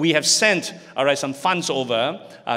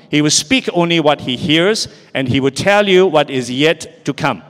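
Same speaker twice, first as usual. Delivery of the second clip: loud congregational scripture reading; many voices in unison; 7 dB louder than usual. Real, not acted. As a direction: read aloud together, loud and steady. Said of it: "Read it aloud together".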